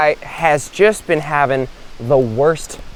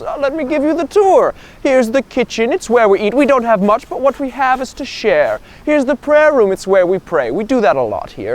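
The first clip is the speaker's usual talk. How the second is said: put-on high-pitched, obliging old monk; monk tour guide; 8 semitones higher than usual. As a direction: show a guest round in a high, eager voice. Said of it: "the monk takes him up and he goes".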